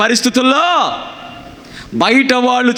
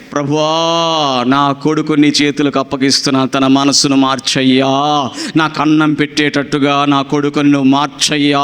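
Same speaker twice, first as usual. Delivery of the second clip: humble prayer; 8 semitones lower than usual. Shown as a demonstration. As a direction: demo of a mother praying for her son. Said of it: "నువ్వేం చేయాలి?".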